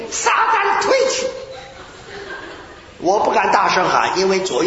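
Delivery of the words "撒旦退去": mock rebuke. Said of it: "我就是奉主的名".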